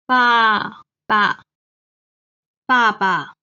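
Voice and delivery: 爸爸 with falling first 爸 and a short light second 爸; neutral tone. Shown as a demonstration. Teacher modelling father word, falling then light neutral. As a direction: drop hard on the first 爸, clip the second. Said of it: "爸 is the neutral tone".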